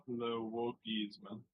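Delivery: slow, soft, low French "le wokisme"; mock French, hushed. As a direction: murmur "le wokisme" like a dainty Frenchman. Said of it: "quote tweeting, going".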